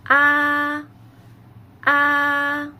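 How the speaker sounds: Mandarin first-tone Ah, steady pitch. Shown as a demonstration. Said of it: "make your sound very flat".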